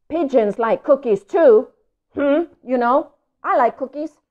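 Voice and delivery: hint hard for a cookie; pushy pigeon. Acted.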